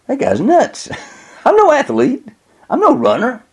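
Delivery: high-pitched, amused incredulity; acted scoffing disbelief. Boy scoffs to himself in story.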